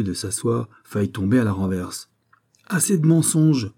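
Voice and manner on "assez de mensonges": harsh, higher and louder rebuke; rough rebuke. A narrator's reading. The judge cuts off the lying prisoner, harsh rebuke. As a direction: cut him off hard. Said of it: "lui intima rudement le juge".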